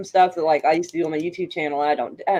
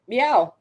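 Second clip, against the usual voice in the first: a playful answering meow, slightly higher; meowing back; 5 semitones higher than usual. Retold or imitated. Mom meowing back at the nurse, playful and mock-serious. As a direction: meow back at her deadpan, as a reply. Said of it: "nodded my head and went".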